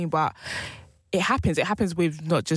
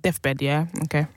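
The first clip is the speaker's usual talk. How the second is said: ordinary talk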